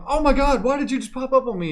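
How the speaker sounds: high, startled cry of surprise; startled yelp. Retold or imitated. A character startled by his invisible teammate, high surprised yelp. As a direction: jump at someone popping up. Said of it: "he'll be like".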